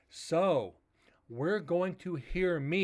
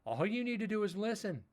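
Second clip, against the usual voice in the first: higher, emphatic retold telling-off; raised-pitch insistence; 7 semitones higher than usual. Telling people at work his voice carries, high insistent voice. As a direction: lift the pitch to make the point to coworkers.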